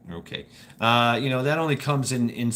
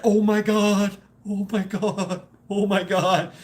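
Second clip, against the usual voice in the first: flustered mom; 7 semitones higher, slower than usual. Retold or imitated. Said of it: "she was like".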